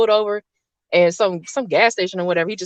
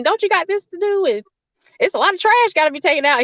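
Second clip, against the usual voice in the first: high-pitched imitation of a fussing mother; high mom voice; 10 semitones higher, faster than usual. Mom trying to stop dad from taking her daughter, high mock fussing. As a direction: squeak out mom's protest, laughing through it.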